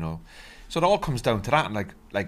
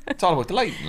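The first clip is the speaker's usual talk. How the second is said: higher, laughing through the line; 9 semitones higher, faster than usual. Real, not acted. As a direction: laugh as you repeat it. Repeating the line, now laughing.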